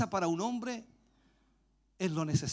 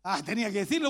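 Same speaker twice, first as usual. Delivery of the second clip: normal talking voice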